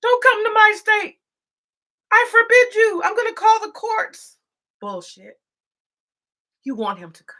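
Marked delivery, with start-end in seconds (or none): 0.0-4.4 mock politician. Mocking governors' staged outrage on camera, a hammed-up loud protest. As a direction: ham up a governor forbidding the visit. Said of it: "That's for theater".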